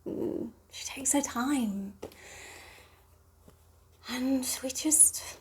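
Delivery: very slow and soft, near whisper; drawn-out murmur trailing into breath; intimate hush. Acted. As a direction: savour it, almost whispering. Intimate memory, savoured slowly.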